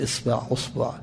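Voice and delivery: إصبع، أصبع said isba, then usbu; إصبع، أصبع softly with changed vowels; vowel variants. Shown as a demonstration. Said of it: "ما يغلط الإنسان".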